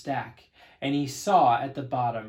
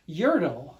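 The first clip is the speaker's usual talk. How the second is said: plain reading voice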